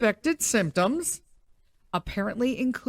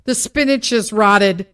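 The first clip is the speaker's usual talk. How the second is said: calling out; 8 dB louder than usual. Retold or imitated. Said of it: "calling out something".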